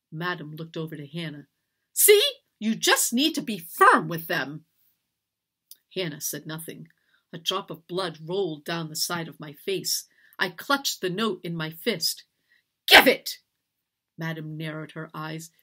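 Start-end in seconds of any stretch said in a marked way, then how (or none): 1.9-4.7 smug lecturing. A narrator's reading. Mistress boasts to Hannah. 12.9-13.4 short, high shout; explosive, raised-pitch command; furious demand. A narrator's reading. Mistress demands the note.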